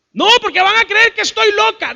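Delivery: very high, loud mock protest; hammed-up high-pitched objection; alarmed woman. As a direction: protest in a put-on panicky high voice. Voices woman fearing being thought crazy.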